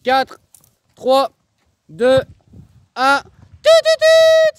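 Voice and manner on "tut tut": whistle imitation.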